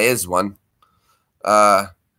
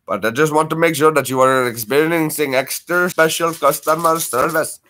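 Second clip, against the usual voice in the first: mock Indian customer-service accent, slightly higher; Apu-style Indian accent; 4 semitones higher than usual. Agent promising special customer service, mock Indian accent. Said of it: "do your Apu voice for them".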